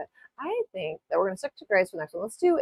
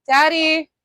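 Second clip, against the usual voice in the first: high, loud repeat call; calling out again; 9 semitones higher, 7 dB louder than usual. Real, not acted. Calling Daddy a second time, loud shout. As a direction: yell his name once more to get his attention. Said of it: "Sorry about the screaming in your ears".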